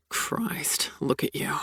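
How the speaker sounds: breathy whispered awe; aroused whisper. Acted. Sebastian to Abigail, staring at her, whispered. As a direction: breathe it out, barely voiced.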